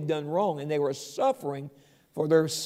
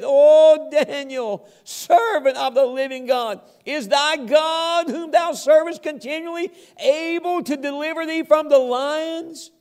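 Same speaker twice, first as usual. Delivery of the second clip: lamenting cry; 8 semitones higher, 7 dB louder than usual. Acted. King calling down into the den, loud and lamenting. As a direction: cry out in grief to the pit. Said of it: "a lamentable voice unto Daniel".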